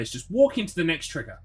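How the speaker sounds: ordinary talk, nothing marked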